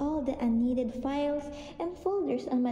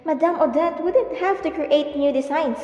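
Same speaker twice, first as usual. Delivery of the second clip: loud insistent protest; raised voice; 3 semitones higher, 9 dB louder than usual. Acted. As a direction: push back hard in her defence. Pleading defence of madam.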